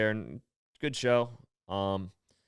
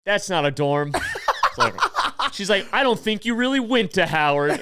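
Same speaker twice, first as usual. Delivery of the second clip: higher and louder, laughing disbelief; mock suspicious; 9 semitones higher, 6 dB louder than usual. Woman catching the comic's lie, mock skeptical. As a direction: call out the fake alum. Woman calls his bluff.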